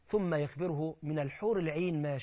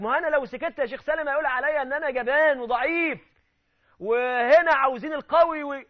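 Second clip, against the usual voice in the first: higher and louder protest, slowing at the end; imitating a defensive hothead; 8 semitones higher, 8 dB louder than usual. Man justifying his anger to the sheikh, loud. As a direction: argue back defensively. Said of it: "يقول لك".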